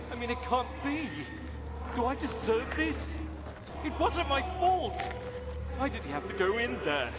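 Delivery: high, anguished, despairing cries; raging at the heavens. Acted. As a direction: rail at fate in disbelief. Man lamenting his fate, distraught.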